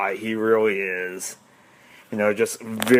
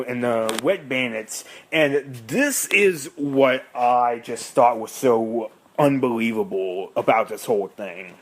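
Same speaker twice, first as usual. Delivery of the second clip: usual speaking voice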